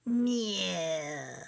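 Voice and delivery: soft, sulky falling meow; a grumpy cat's meow. Acted. Kitty answers again.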